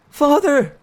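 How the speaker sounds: put-on high, loud boy's cry to his father; a son's anguished shout across the room. Boy cries out as his father is thrown down, loud and high.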